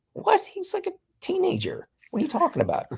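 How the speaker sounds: high, squeaky disbelief; mock outraged disbelief at wife's news; incredulous. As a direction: shoot way up in pitch like you can't believe it. Incredulous reaction to wife.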